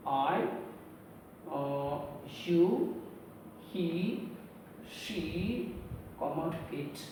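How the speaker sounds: slow, soft, one word at a time; writing aloud. Real, not acted. Teacher writing the pronouns for the class, spacing them out slowly. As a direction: say each word softly as you write.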